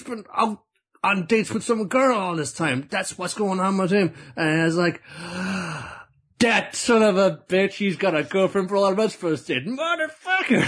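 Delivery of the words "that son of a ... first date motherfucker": higher, hammed-up jealous rant rising to a yelp; mock jealous outrage. Brothers cursing the one with a girlfriend, in mock outrage.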